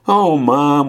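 put-on high, mildly whiny child's complaint; whining. A narrator's reading. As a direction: whine up at Mom like a put-upon kid. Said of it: "whined Cassie after a bit".

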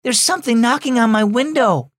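put-on high, childlike worried voice; scared little boy reporting a noise; frightened voice. Little brother tells his sister about the window, high frightened voice. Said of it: "said in a frightened voice".

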